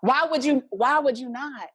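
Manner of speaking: a hammed-up indignant comeback; indignant retort.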